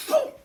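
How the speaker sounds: sudden shrill whoop shot way up high and loud; whoop sound. Retold or imitated. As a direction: make the chicken whiz by with a yelp. Chicken zooms past the car.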